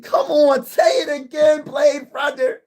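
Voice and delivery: high, crying shout of hype; hyped cheering. Real, not acted. Streamer cheering a fan on, hyped crying shout. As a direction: yell it out like a fan losing it.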